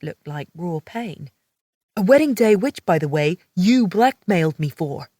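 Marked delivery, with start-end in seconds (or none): 1.9-5.1 louder male retort, biting; angry retort. A narrator's reading. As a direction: hit back at her. Riggs retorts angrily.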